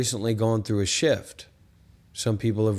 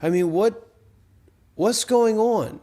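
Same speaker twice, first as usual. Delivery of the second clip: higher, louder, slow exasperated question; exasperated outcry; 9 semitones higher, 3 dB louder than usual. Real, not acted. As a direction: throw up your hands and demand what's going on. Protests that nobody may stop.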